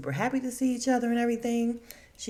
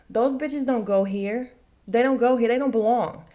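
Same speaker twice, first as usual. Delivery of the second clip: loud, higher, insistent protest; indignant rant; 4 semitones higher, 7 dB louder than usual. Real, not acted. Viewer ranting about guests who don't belong, indignant. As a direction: hammer each repeated objection harder.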